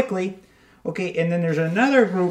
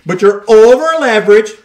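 emphatic mock lecturing; 9 dB louder than usual.

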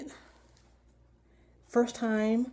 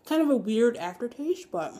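chewing while talking; speaking around food; mouth partly full. Real, not acted. Seen 2: Bit toast at -3; jaw still working, toast in hand while speaking.

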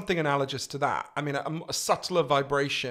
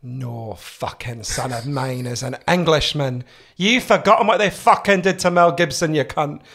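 outraged Scottish mother, building louder; Scottish accent, slow opening then loud scolding; strong Scottish accent; 7 dB louder than usual. Retold or imitated. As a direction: rant in a thick Scottish accent. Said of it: "strong Scottish accent".

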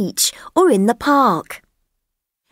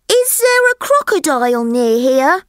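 higher, eager little elephant voice; curious elephant child; 7 semitones higher than usual. Acted. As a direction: ask like a wide-eyed young elephant. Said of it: "said the elephant's child".

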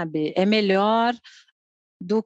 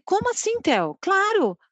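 retold surprise, leaping high in pitch; surprised mother; 10 semitones higher, slower than usual. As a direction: jump up high as if taken aback. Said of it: "eu fiquei tão surpresa". (mãe surpresa, em tom agudo)

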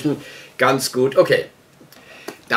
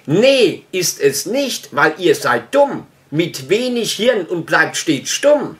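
rhythmic rhyming verse, slow in places; mildly performed verse reading; poem recitation; 3 semitones higher, slower than usual. Acted. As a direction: declaim it line by line like a poem.

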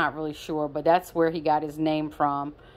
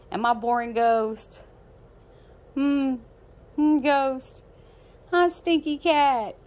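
pet talk; 8 semitones higher, slower than usual. Real, not acted. Baby talk to her cat.

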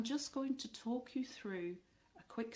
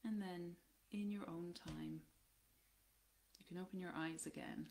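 guided body scan; 5 dB quieter than usual. Acted. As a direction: guide them gently down through the body, unhurried and even.

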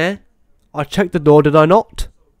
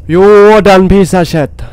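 louder, higher mocking greeting, drawn out slowly; mock taunt; 4 semitones higher, 6 dB louder, much slower than usual. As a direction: gloat at the monster like a cocky villain. Taunting the game monster.

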